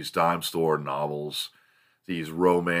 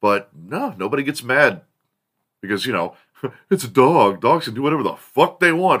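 ordinary delivery

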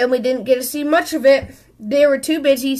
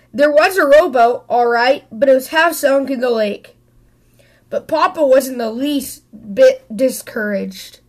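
plain reading voice

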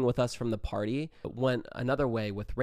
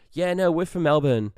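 loud, higher fake Aussie accent; insistent Australian accent; 4 semitones higher, 8 dB louder than usual. Convincing real Australians he is local, insistent fake Australian accent. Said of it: "trying to convince them that we're actually Australian".

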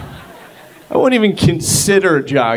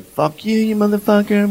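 imagined yell; 8 semitones higher than usual. Retold or imitated. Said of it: "I'm gonna yell at him".

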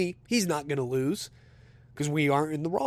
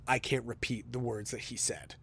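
very serious; 3 semitones lower, 9 dB quieter than usual. Retold or imitated. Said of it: "very seriously goes".

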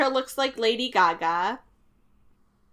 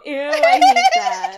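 laughing disgust; 7 dB louder than usual. Real, not acted. Reacting to band name, laughing.

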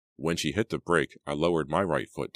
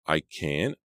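ordinary delivery, nothing marked

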